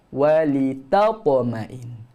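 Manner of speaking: walitatma'in very slow with a thin ta; light ta. Shown as a demonstration. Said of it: "Jangan huruf ta tu jadi tebal".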